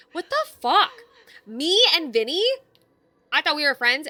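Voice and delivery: loud, high shocked yell; shocked outburst. Real, not acted. Streamer reacting to a friend's betrayal, shocked yell. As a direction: blurt it out in disbelief.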